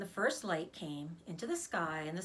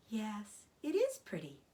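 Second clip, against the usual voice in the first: Charlotte, weak spider. Acted.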